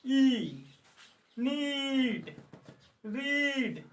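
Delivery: नीड and रीड with a long held ee; long ee demo. Shown as a demonstration. Teacher modelling the long ee vowel for students. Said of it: "बड़ी ई का उच्चारण".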